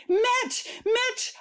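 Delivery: high panicked shriek of a name. Acted. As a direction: call out in shock, high. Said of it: "I shrieked".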